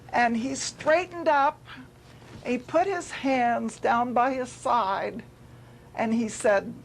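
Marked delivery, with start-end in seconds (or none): none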